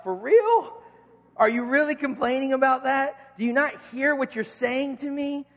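mock outrage at a trivial gripe; incredulous disbelief. Cancer patient hearing about a zit, incredulous.

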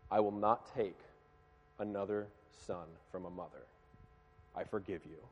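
hushed, low, halting words of a grieving mother; retold tearful forgiveness. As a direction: drop very soft and low, understated grief, long pauses. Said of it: "And she's bawling".

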